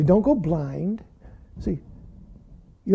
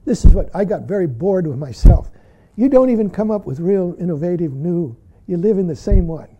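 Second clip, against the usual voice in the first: ordinary talk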